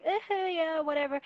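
condescending. Retold or imitated. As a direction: imitate someone dismissively brushing people off. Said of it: "being a condescending".

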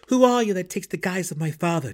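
loud, high challenge; angry demand. Acted. Drizzt confronts the spirit wraith.

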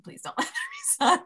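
pleading that cracks into a laugh-cry; laughing plea. Retold or imitated. Praying on the trail for no sunshine, laughing through the plea. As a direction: let the prayer break into laughter. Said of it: "And I'm just like".

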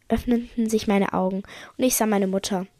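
usual speaking voice

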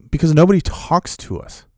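high-pitched retold grievance; venting. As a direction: imitate exasperated complaining. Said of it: "he just starts venting to me".

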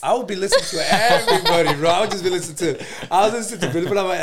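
laughing it out, high and loud; half-laugh the words through a grin. Real, not acted. Teased by friend, answering while laughing. Teased about his radio habits.

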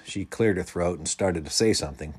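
ordinary delivery, nothing marked

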